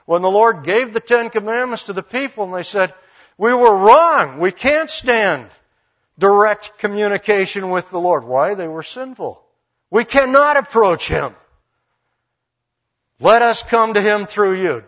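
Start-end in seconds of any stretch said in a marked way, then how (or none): 3.4-8.2 raised, high-pitched acted plea; loud, emphatic confession; urgent plea. People's alarmed plea to Moses retold. 9.9-14.8 loud, high acted entreaty; emphatic, declaimed appeal; urgent plea. People beg Moses to mediate.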